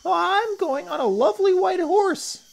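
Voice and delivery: put-on loud, very high-pitched child; shrill, thrilled little-child shout; excited cry. A narrator's reading. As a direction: squeal with delight like an excited kid. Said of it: "cried another".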